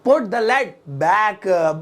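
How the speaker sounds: very slow, each word stretched out; slow model repeat. Shown as a demonstration. Teacher repeats the new English sentence for learners, slow and drawn out. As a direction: draw out "put the lid back।" word by word.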